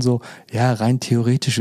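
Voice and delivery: ordinary delivery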